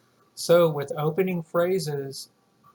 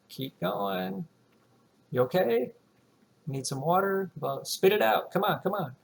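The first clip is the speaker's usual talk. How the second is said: lightly voiced, higher, coaxing listener; impatient listener; 5 semitones higher than usual. Listener urging the speaker to finish, mildly impatient. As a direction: nudge them to finish the sentence. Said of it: "they're gonna look at you like".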